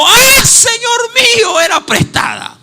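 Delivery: loud, high cry with a drawn-out "Ah"; acted wail of dismay, starting high and slow; alarmed shout. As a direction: cry out in instinctive shock at an accident. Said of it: "gritando, dijo".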